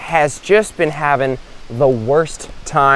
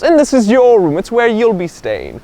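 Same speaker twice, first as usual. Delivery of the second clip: monk host; 7 semitones higher than usual. Acted. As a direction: present the room with a flourish. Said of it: "the monk turns to one of them and goes".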